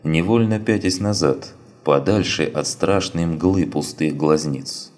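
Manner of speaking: plain reading voice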